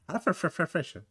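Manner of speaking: quiet throwaway American impression; mock American accent. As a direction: parody American speech, syllables run together. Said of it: "Así hablan los, los americanos".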